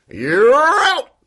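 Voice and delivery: high, loud, drawn-out yell; swooping shouted punchline; umpire-like call. Acted. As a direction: holler the verdict with relish. Announces the strike-system penalty.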